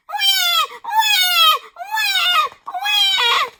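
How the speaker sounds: put-on very high, loud baby wail; baby screaming. A narrator's reading. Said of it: "screamed really loudly".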